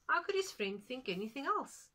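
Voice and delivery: how could anyone doubt it; a mock-amazed aside; incredulous. A narrator's reading. Narrator mocks disbelief.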